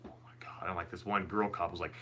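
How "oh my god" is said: hushed, voiceless gasp of disbelief; whispered shock. Retold or imitated. Cops reacting to his lie, shocked whisper. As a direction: whisper it, imitating stunned onlookers.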